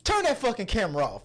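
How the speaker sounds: hammed-up indignant command; mock alarmed demand. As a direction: play a startled partygoer ordering someone. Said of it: "That's what they would say".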